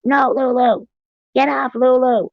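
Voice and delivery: high, loud yell at a pet; yelling at the cat. Retold or imitated. Owner yelling at her cat to get off. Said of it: "I was freaking yelling at my cat".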